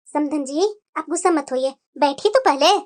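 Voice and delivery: calm the angry in-law meekly; placating older mother. Acted.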